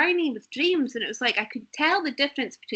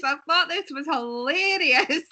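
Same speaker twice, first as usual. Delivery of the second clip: normal talking voice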